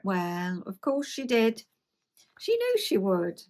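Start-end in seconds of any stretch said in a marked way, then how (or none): none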